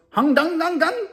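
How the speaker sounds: high nonsense-language imitation; short high run of pretend words; mock foreign gibberish. Inmate answering the captain, high mock gibberish. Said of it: "the dude who was talking like that".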